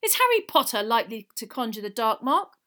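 loud indignant challenge; very angry. A narrator's reading. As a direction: challenge the absurd accusation. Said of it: "very angrily".

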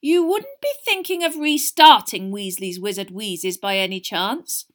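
suspicious. A narrator's reading. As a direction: press the twins with a leading question. Mother suspects the twins' scheme.

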